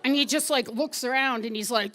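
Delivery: ordinary delivery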